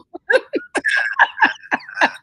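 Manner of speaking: loud, high burst of laughter; real, hearty, high-pitched laugh. Real, not acted. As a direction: laugh out loud at your own joke. Laughs at own quoted joke.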